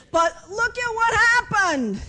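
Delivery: high, slow, loud whine; mock complaint. Mimics Elijah's pity-party complaint.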